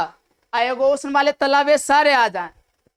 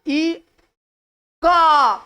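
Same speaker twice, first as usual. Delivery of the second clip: का punched louder after a raised ई; stress on का; 4 semitones higher, 4 dB louder, much slower than usual. Shown as a demonstration. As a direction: lean hard on the syllable का. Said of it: "ज्यादा डल, डल दिए".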